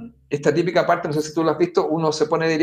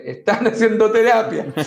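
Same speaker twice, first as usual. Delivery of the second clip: laughing as he tells the neighbours; a laughing joke about therapy; 4 semitones higher, 4 dB louder than usual. Retold or imitated. A man jokes to his neighbour, laughing through it. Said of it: "yo también le digo".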